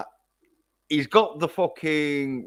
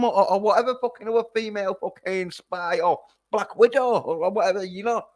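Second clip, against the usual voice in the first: incredulous rant; 11 semitones higher than usual. Real, not acted. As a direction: pitch shooting way up in disbelief. Incredulous rant about female Bond.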